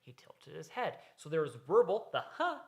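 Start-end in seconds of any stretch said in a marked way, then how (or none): 2.2-2.6 huh that swoops far up in pitch; a wondering huh that jumps high; high rising huh. Shown as a demonstration. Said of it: "the up pitch at the end".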